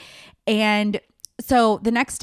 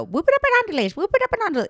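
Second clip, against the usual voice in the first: higher, sing-song party cheer; repeating it like a stuck tune; Vicki Gunvalson catchphrase; 13 semitones higher, 3 dB louder, much faster than usual. Retold or imitated. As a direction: chant the catchphrase on loop. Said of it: "going on and on in my head".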